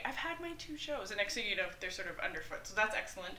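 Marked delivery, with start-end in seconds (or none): 0.0-1.1 lightly voiced child, pitched higher; a higher-pitched take on a child reporting back; child quote. Expert quoting children on their screen time, lightly voiced child. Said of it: "children will say".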